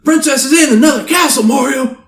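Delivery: high, loud shouted movie-star impression; mock-outraged high-pitched shout; Samuel L. Jackson. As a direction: belt it out at Mario, high and hammy. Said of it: "Samuel L. Jackson and his voice go".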